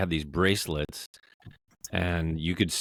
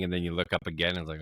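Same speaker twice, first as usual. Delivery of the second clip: ordinary talk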